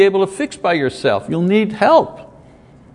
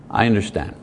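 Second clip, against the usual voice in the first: lower, softer forgiving words; forgiving wife; 7 semitones lower, 6 dB quieter, faster than usual. Acted. Wife forgives husband who came late, soft and low. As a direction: let it go gently, softly and lower. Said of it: "or she can say".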